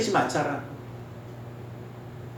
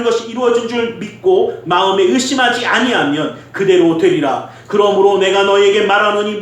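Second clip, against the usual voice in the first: loud, measured Bible reading; scripture reading; 4 semitones higher, 5 dB louder than usual. Acted. Preacher reads Jesus' words to the congregation, loud scripture reading. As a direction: read scripture out firmly, drawing out the final phrases.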